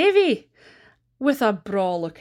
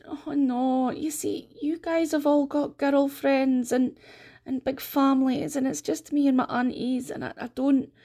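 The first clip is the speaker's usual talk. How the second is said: lightly voiced shy lad; hesitant, higher voice of a shy laddie; shy Davy; 5 semitones higher than usual. Davy voiced in told story.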